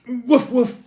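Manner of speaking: high loud happy woof; loud cheerful dog barks; happy barking. A narrator's reading. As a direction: bark with joy. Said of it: "Peppy barked happily".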